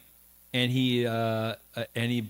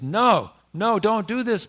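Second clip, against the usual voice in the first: raised, higher-pitched protest; acted pleading, pitched up; urgent plea; 7 semitones higher, 5 dB louder than usual. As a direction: beg him to stop, emphatically. Acted plea at lord's feet.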